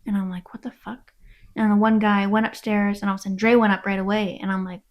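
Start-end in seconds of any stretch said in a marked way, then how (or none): 0.5-1.1 hushed, whispered quote of yourself; baffled aside under the breath; whispered disbelief. Retold or imitated. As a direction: whisper it, retelling a private reaction. Retelling reaction to something overheard.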